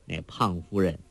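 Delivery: ordinary delivery, nothing marked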